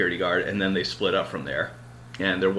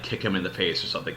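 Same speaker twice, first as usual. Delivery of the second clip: ordinary talk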